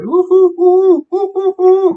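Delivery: high loud owl hoots; owl hoot call. Retold or imitated. Answering the owl in the dark woods with loud high hoots. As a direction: hoot back like an owl across the flat. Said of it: "kinda call back".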